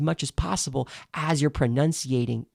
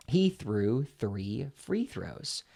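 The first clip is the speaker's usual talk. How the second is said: slow and deliberate; 3 semitones lower, 4 dB quieter, much slower than usual. Shown as a demonstration. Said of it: "staccato with the pen in your mouth".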